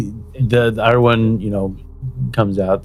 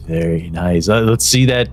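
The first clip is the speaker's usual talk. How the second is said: usual speaking voice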